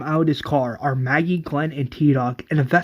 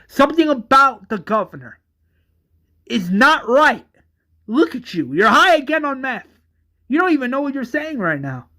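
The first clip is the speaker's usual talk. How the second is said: angry sister; 8 semitones higher than usual. Acted. Sister yelling at high brother.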